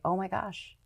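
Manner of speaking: hushed, dawning realisation; retold quiet astonishment. Reporter recalls hearing Canadian news after moving, softly amazed. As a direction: drop the volume as it sinks in.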